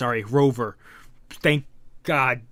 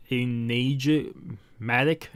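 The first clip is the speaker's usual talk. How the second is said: ordinary delivery, nothing marked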